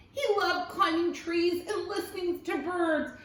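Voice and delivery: ordinary delivery, nothing marked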